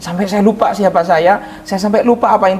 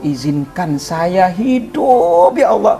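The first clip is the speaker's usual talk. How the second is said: retold tearful cry; 10 semitones higher than usual. Reliving his prayer for minutes of life, high anguished pleading. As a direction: beg God desperately, voice soaring. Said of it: "berteriak, nangis sama Allah".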